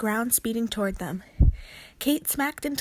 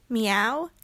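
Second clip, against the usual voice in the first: cat meow; 3 dB louder than usual. A narrator's reading. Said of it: "You sound like a cat".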